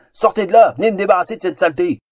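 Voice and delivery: loud, high, fast ogre shout; thundering ogre. Acted. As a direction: bellow at them, exasperated. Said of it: "tonitru à l'ogre exaspéré".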